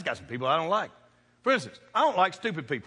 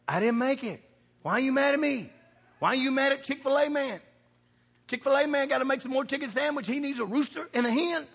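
ordinary talk

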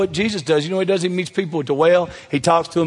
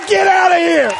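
shouted dismissal; 12 semitones higher, 6 dB louder than usual. Shown as a demonstration. Preacher shouts how judgmental church folks reject sinners, loud. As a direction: Get out of here yelled high and loud. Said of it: "He does not say".

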